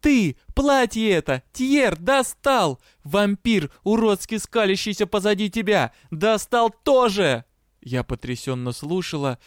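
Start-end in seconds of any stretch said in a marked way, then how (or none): 0.0-7.4 high, loud rant hammered out; furious yelling. A narrator's reading. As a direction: yell through a list of grievances. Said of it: "орала вампирша".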